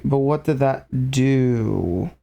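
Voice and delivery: very slow stretched question; slow every word right down; slow motion. Pretend. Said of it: "talk in slow motion".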